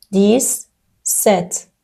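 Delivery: دیس ست slowly, s sound clear, no z; slow French dix-sept. Shown as a demonstration. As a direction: spell out دیس ست for learners. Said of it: "توی هفده گفتیم دیس ست". (دیس ست آهسته با صدای س)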